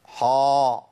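long حا with a thick backed vowel; over-heavy, full-mouthed حا; faulty heavy ح. Shown as a demonstration. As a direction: darken and hold حا, towards "ho". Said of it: "حا دي مش موجودة في القرآن".

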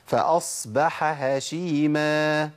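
فأصبح with an open breathy ح before هشيما; clear ha. Shown as a demonstration. Teacher modelling the correct reading. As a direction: stretch فأصبح slowly, ح fully breathed. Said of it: "أقول إيه".

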